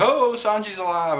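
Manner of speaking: high, drawn exclamation of imitated amazement; mock surprise. Barati crew hearing about Sanji, lightly acted surprise. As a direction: react as if just hearing good news. Voicing the Barati crew's reaction.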